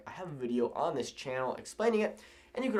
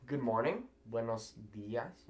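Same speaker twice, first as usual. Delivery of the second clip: ordinary talk, nothing marked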